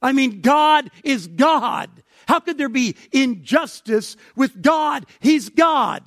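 loud, high preaching exclamation; emphatic rebuttal. Acted. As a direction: declare it forcefully, pounding the key word. Preacher acting out Paul's emphatic rebuttal.

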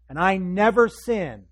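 loud, high, slow self-righteous claim; indignant protest. Acted. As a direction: proclaim your innocence, punching it out. Resentful brother boasting of record.

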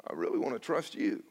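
low and soft, barely voiced; quiet plea. Young believer answering God at home, soft and pleading. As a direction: confess it gently, almost ashamed.

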